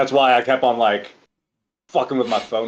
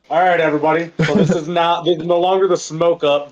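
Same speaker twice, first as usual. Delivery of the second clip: mock show host; 6 semitones higher, 4 dB louder than usual.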